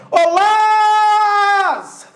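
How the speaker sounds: high, very slow call holding "Lá" in "Lázaro"; calling out the name. Shown as a demonstration. Teacher showing the tonic syllable of Lázaro, calling out. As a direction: holler it with a long first syllable. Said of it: "Demora mais tempo sendo chamada".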